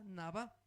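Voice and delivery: nabá, stressed on its second syllable; acento en la última A. Shown as a demonstration. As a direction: put the stress on the final á of "nabá". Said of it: "con acento en la A". (nabá, con la última á acentuada)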